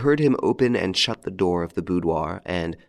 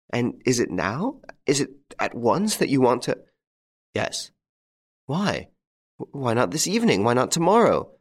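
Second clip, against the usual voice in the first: higher, rising alarm; alarmed pleading; 6 semitones higher than usual. A narrator's reading. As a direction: plead in panic for a delay. Wife panics at sudden interview.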